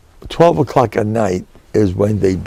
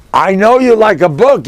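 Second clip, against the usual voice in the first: quoted fan; 10 semitones higher, 4 dB louder than usual. Retold or imitated. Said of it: "he tells me".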